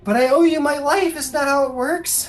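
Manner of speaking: put-on high, loud puzzled kid voice; insistent child. Child insists on owing a life, high protesting voice. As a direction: protest it like a confused youngster.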